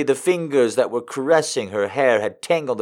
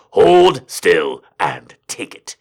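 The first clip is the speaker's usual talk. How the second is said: lower, slow and firm; firm order; 6 semitones lower, 4 dB louder, slower than usual. A narrator's reading. Don orders his pet to hold still, slow firm command. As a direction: order her slowly, no argument. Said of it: "he ordered firmly".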